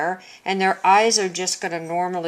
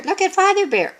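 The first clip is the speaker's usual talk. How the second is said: much higher storybook character voice; Mother Bear; 13 semitones higher, 4 dB louder, faster than usual. Shown as a demonstration. Teacher showing voice change for speech marks, Mother Bear voice. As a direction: pitch it way up as a bear character speaking. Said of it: "sound like Mother Bear and Baby Bear".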